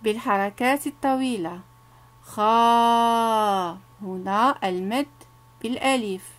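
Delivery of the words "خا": خا held long as a drawn-out "khaa"; sustained long-vowel model; long vowel demo. Shown as a demonstration. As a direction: stretch the "aa" in خا. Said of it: "سأقرأ عليكم".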